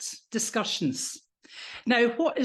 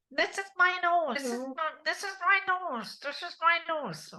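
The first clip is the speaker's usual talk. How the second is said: nasal voice; 6 semitones higher than usual. Shown as a demonstration. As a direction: voice placed in the nose, higher. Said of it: "Take your voice to your nose".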